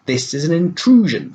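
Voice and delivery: high, loud affronted protest; outraged at uninvited lodgers; indignant. Acted. Bees lodging in her storeroom.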